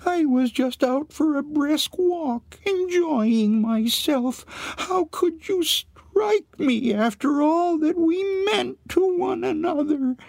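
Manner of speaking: very high, tearful ghost-hog falsetto; Anne's voice. A narrator's reading. Hog reproaches Arthur for striking her, high and plaintive. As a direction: wail it in a squeaky, hurt voice. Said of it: "it was Anne's voice he heard".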